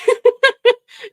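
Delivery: loud high shriek of laughter; squealing laugh. Real, not acted. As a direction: crack up hard at the reply. Cracking up.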